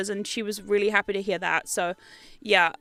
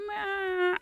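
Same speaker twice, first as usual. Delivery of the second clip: high, soft mewing cat; cat meow; 8 semitones higher, 6 dB quieter than usual. Retold or imitated. Owner voicing her cat's sad meow. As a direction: mew softly like a sad cat. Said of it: "Colonel was going around the house going".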